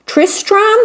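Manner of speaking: high, hopeful queen guessing Tristram; queen asking. Acted. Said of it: "Did you say Tristan or Tristram".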